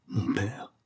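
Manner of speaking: hushed, halting question; stunned stammer. A narrator's reading. Prisoner seeing his father at the cell door, stunned. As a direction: falter softly in disbelief. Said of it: "balbutia-t-il".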